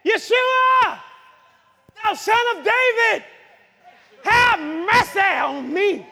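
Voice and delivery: high, loud drawn-out cry for mercy; acted beggar's holler. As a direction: call out desperately over a crowd. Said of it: "And he was hollering out".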